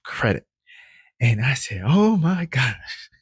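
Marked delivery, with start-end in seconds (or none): 1.8-3.1 drawn-out, much higher, slow "oh my gosh"; retelling the moment the big approval came in; excited gasp. Retold or imitated. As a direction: let the amazement stretch the words out. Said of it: "And I was so excited".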